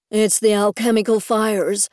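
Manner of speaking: usual speaking voice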